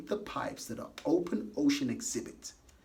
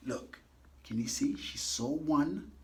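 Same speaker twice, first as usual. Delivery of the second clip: usual speaking voice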